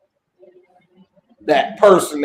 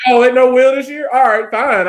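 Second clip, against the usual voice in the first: higher, louder mock sulk; sarcastic mock sulk; 7 semitones higher, 6 dB louder than usual. Co-host mocking the missing wheel, sarcastic sulk. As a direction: sulk it out loud, fast and sarcastic.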